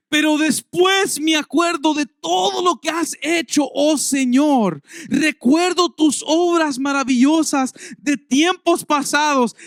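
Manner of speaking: loud, high, slow psalm read with passion; fervent scripture reading. Acted. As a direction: proclaim the psalm like preaching. Reads psalm with fervor. (lectura ferviente y lenta del salmo)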